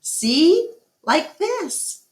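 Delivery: put-on high, amused girl voice; young girl's voice. A narrator's reading. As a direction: Julia laughing at the gorilla, high girlish voice. Julia shows how to paint.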